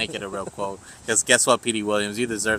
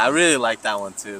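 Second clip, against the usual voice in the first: ordinary talk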